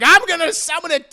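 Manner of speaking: loud, high, fast outburst; angry yell. Acted. JoJo yelling down at Dio, fast and loud. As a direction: blurt it out in rage.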